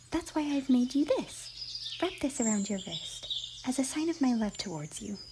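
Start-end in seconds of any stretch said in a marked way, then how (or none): none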